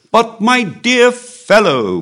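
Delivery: high, drawn-out cry of protest; loud exclamation. A narrator's reading. Comte protesting to his dear fellow, exclaiming loudly. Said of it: "cried the Comte".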